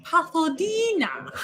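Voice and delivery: thick put-on vampire voice; Naja impersonation. Shown as a demonstration. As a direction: play the vampire, stretching the word out high. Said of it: "you can do the, the vampire voice".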